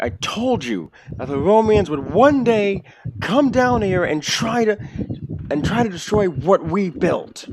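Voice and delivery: an orc stirring up the crowd, slow and loud; orc agitator. Acted. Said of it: "And he's like".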